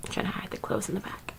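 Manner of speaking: very soft, quick whisper; whispered aside. Real, not acted. Vlogger admitting to hiding clothes, whispered aside. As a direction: murmur it as a hushed aside.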